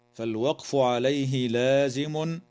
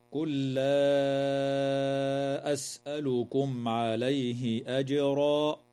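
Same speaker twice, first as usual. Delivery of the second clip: slow chanted tartil, lam of قل merged into لا; slow Quran recitation; slower than usual. Shown as a demonstration. Sheikh reciting the verse slowly for students. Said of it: "اللام في كلمة قل تسمى بلام الفعل".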